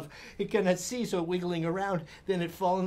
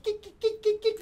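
panicked kicking. Retold or imitated. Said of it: "I'm like".

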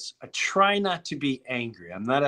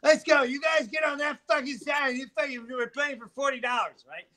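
mock Norm yelling; 6 semitones higher than usual. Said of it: "Norm is like".

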